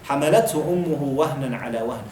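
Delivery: rapid Arabic verse recited; brisk Quranic recitation; quick Quran recitation. Acted.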